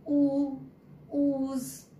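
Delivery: teacher modelling the long oo sound; long stressed oo. Shown as a demonstration. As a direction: hold the oo and push it. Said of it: "we spoke the oo sound with lot of stress".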